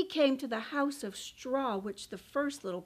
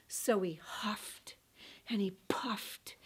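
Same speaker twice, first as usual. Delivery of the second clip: soft breathy whisper; 5 semitones lower, 6 dB quieter than usual. A narrator's reading. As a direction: whisper the huffing. Wolf blowing.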